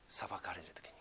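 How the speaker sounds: soft unvoiced whisper. Real, not acted. Pastor hushed while describing God weeping. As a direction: let it fall to a whisper.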